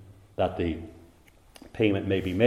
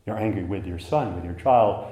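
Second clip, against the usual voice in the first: normal talking voice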